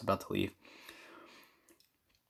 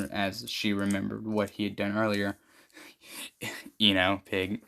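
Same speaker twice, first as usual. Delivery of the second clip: usual speaking voice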